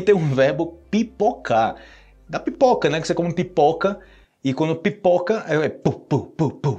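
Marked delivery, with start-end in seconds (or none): 5.5-6.8 little puffed pu sounds like kernels bursting; popcorn popping noise. Shown as a demonstration. Teacher imitating popcorn popping for students, light puffs. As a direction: imitate popping corn with short pops. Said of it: "faz esse barulho".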